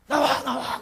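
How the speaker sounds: loud urgent call with a laugh; half-laughing loud order; mock shout. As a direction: yell for people to move aside. Imagined shout to clear the way.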